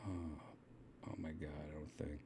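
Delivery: very soft, low muttering; real half-whispered, low pleading to oneself; muttering to self. Real, not acted. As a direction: mumble under your breath while searching. Mutters to self while searching.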